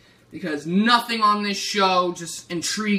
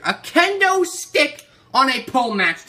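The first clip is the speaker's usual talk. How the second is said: louder and higher, repeating in disbelief; incredulous outrage; 7 semitones higher, 5 dB louder than usual. Real, not acted. Ranting to viewers about the kendo stick match, incredulous and loud. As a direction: hammer the repeat like you can't believe it.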